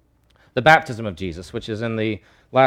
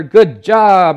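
loud, higher congratulation; hearty praise; 6 semitones higher, 9 dB louder than usual. Acted. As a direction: cheer him on heartily. Jesus' praise of Peter acted out.

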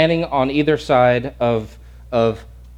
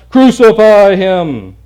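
slow, loud drawn-out chant; crowd shouts; 6 semitones higher, 9 dB louder, much slower than usual. Retold or imitated. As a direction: stretch it out like a mob. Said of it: "the crowd shouts".

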